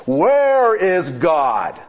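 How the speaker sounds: hammed-up slow preacher roar; bellowing preacher. A narrator's reading. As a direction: bellow it down at the boy.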